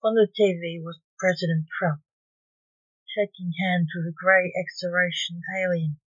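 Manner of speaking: ordinary delivery, nothing marked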